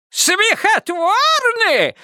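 put-on high shrill old man squeal. A narrator's reading. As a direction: squeal it out slowly, outraged. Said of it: "взвизгнул дед Лучок".

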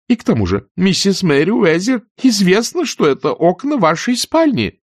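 put-on high, fluttery elderly aunt voice, shocked; fussy aunt scolding. Pitty keeps scolding. (высокий суетливый голос тётушки, возмущённо)

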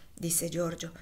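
usual speaking voice

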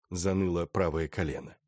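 plain reading voice

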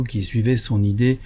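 ordinary delivery

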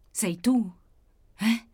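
insistent, mildly hollow questioning; cavernous voice. A narrator's reading. Wounded woman questions her sister, cavernous voice. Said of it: "disse con voce cavernosa la ferita".

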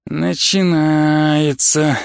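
very slow, long-stretched vowels; weary here-we-go drawl. A narrator's reading. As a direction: draw the word right out. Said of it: "протянул Шенк".

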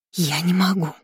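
quick panting breaths; breathless. A narrator's reading. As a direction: gasp it out, breathing fast. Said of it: "часто дышала та".

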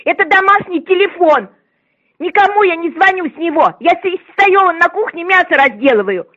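usual speaking voice

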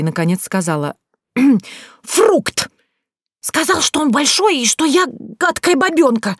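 high, breathless indignant complaint; flustered indignation. Acted. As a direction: stumble over the embarrassing word.

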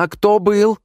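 high, loud demand; attacking. Retold or imitated. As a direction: pounce on her with it. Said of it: "Я на неё налетела".

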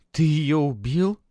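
high, slow, accusing question; angry accusation. A narrator's reading. Girl confronting Matvey over her mother, angry accusation. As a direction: draw it out high in angry disbelief. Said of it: "сверкнули яростным огнём".

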